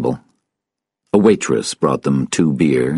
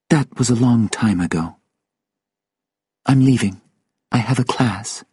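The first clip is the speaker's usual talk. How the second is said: higher character voice; woman character, reserved; 7 semitones higher than usual. Acted. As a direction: say it as the woman, pitched up. Sylvia's voice, ending the conversation.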